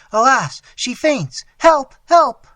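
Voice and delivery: very high, loud cry for help; mother crying for help over her fainting daughter; calling for help. Acted. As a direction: scream for help in panic.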